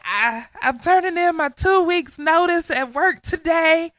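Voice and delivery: high, loud, tearful outburst; calling a friend at dawn, retelling the sobbing announcement; retold sobbing. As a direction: blurt it out as if sobbing on the phone. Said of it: "sobbing because I was like".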